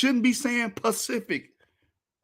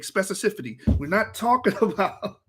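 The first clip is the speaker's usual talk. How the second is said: ordinary talk, nothing marked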